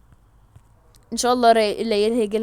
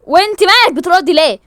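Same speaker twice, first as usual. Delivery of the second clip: mock angry snap; 6 semitones higher, 12 dB louder than usual. Family quarrel.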